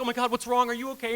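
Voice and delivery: imitating Erica's panicky concern; worried friend. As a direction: mimic her alarmed concern. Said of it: "And she's like".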